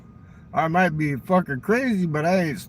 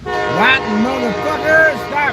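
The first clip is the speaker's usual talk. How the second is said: higher, louder and slower cursing shout; angry yell; 9 semitones higher, 6 dB louder, slower than usual. Acted. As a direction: yell at the driver to stop honking. Cursing train horn.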